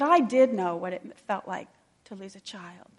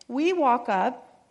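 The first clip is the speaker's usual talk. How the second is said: ordinary delivery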